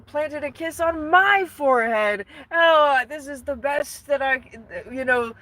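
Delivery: high, melodramatic lovesick Phantom; hammed-up dying-of-love swoon. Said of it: "he's like".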